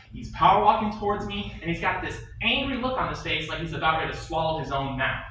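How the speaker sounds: ordinary talk, nothing marked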